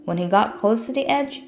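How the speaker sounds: ordinary delivery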